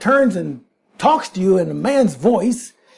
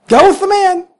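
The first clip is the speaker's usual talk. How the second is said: high, loud command; commanding angel; 11 semitones higher, 5 dB louder than usual. Acted. Angel ordering Balaam onward, a high loud command. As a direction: proclaim it from above.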